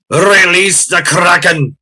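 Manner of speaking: mock epic proclamation. Movie line quoted for fun.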